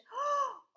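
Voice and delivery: high sobbing gasp of relief; relieved gasp. Acted. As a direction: gasp as if you've just found him. Mum spots baby up the road.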